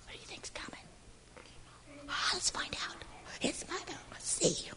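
hushed whisper building suspense. Real, not acted. Teasing the children about who comes next, whispered. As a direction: whisper it like a secret.

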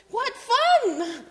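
laughing high delight; gleeful laugh. Acted. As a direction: giggle it out. Gleeful reading.

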